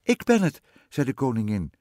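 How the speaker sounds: high, loud queen voice; queen calling up. A narrator's reading. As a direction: call up to someone in a treetop. Queen calls up to witch in tree.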